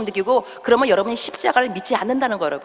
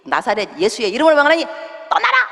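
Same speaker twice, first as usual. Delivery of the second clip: forceful deliverance-prayer command; commanding rebuke. Shown as a demonstration. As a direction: order the spirit out, firmly. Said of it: "이거 할 줄 알아야 돼요". (단호하게 명령하는 대적기도)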